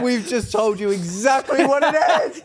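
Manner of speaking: talking through loud laughter; laughing. Real, not acted. Laughing at giving it away.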